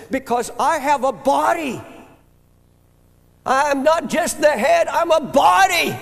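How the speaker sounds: rising to a full preaching shout, high and loud; God's voice. Retold or imitated. Preacher voicing God rebuking David, loud shout. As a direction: build from firm to a shouted rebuke. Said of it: "God said".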